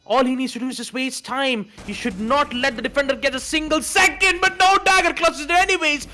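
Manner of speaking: high, loud hype call; esports caster. Real, not acted. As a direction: scream the clutch to the crowd. Live play-by-play, clutch round.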